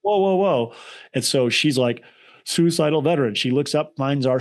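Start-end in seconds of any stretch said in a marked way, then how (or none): 0.0-0.7 freaked-out whoa. Retold or imitated. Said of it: "and she freaks out".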